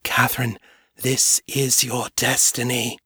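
whispery lure from behind a door; earnest whisper. A narrator's reading. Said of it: "whispered to her more earnestly now".

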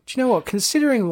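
high, loud and pleased with herself; proud burst of delight at her own makeup; excited self-praise. Retold or imitated. Thrilled with her own zombie makeup in the mirror, excited. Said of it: "I was like".

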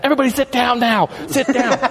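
high, fast mock yell; retold command. Friend orders the audience to sit, high fast mock yell. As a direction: bark it up high and quick. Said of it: "he has to tell everybody to sit down".

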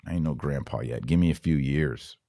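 ordinary talk, nothing marked

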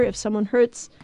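normal talking voice

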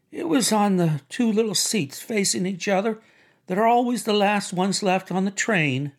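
confiding woman's voice, pitched well up; Myrtle recounting eagerly. Acted. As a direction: tell it in a high, eager chatter. Myrtle tells her story.

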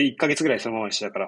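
ordinary delivery

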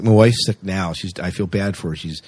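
ordinary talk, nothing marked

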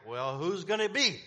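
high, loud, mock-desperate question; urgent wondering question. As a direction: cry it out like a reader left hanging. Acting out readers' suspense at ending.

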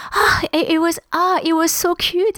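high, swooning delight; charmed gushing. Real, not acted. Recalling being charmed by future husband.

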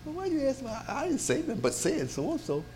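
high, soft, mock-innocent excuse; defensive excuse. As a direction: play the innocent who got caught. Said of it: "why would you say".

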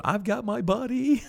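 high-pitched, playful mock yell; squealed impression of calling out; imitating Robin's yell. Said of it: "Robin yell out".

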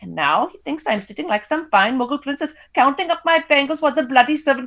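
put-on high, scornful wife with an Indian accent; Indian mother's voice. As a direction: complain indignantly, speeding up as you go. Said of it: "I read in my characters' voices".

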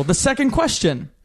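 bright quiz host announcing; game show host voice. Acted. Hosting the quiz.

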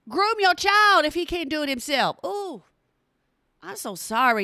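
ordinary delivery

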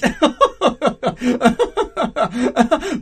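loud, high, prolonged desperate giggle; nervous laughter. Acted. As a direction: laugh far too long in panic. Panicking prince laughs nervously.

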